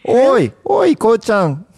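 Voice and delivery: put-on high calling "Oi, oi, ko-chan" like a mother to her kid; mother calling her kid, high and playful; mother calling child. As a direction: call the child up high and sing-song. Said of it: "I hear that a lot. Like".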